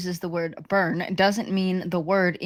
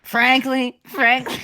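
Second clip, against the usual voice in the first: slightly higher, hammed-up political impression; Donald Trump; 5 semitones higher, 4 dB louder than usual. Host joining the joke, attempted Trump impression. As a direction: try out the politician's catchword, lightly put on. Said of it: "I can't do a Trump".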